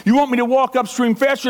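ordinary talk, nothing marked

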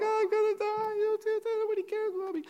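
very high-pitched fake crying; mock blubbering. Bride crying all through the feast, high mock blubbering. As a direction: wail it like a blubbering bride, hammed-up. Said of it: "like, blubbering the whole time".

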